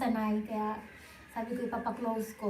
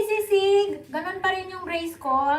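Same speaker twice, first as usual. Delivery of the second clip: ordinary delivery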